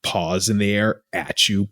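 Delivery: usual speaking voice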